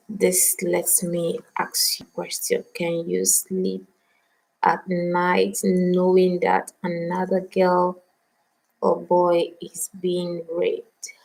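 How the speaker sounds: slow, soft, sinking to a whisper by the end; slow questions to viewers fading into a whisper; hushed, solemn question. Real, not acted. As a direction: ask it slowly, almost breathing the last words.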